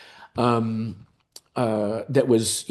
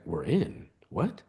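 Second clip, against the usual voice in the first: stunned disbelief; 3 semitones lower, 8 dB quieter, slower than usual. Retold or imitated. Reacting to his friend's news, quietly stunned. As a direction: echo the news softly, incredulous.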